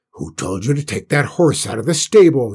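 loud, outraged demand, rising to a peak; narrated shout, bursting on the last word; angry shout. A narrator's reading. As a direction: shout it like a furious boss. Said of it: "he shouted".

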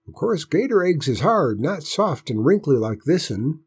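high, lilting country grandpa; folksy granddad pondering; old grandpa, musing. Acted. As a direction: reason it out aloud for the boy. Voices grandpa musing on egg.

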